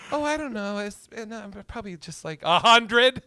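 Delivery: put-on high, breezy girlish voice; lightly voiced casual girlfriend; mock girlfriend. Imitating the girlfriend shrugging off her number, high and breezy. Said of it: "she's like".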